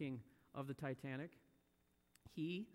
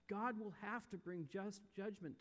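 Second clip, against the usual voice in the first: ordinary delivery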